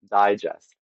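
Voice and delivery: "digest" said DIE-jest; first-syllable stress. Shown as a demonstration. Host demonstrating the noun, stress on first syllable. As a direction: punch the opening syllable of "digest". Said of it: "stressed on the first syllable".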